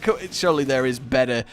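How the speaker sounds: ordinary talk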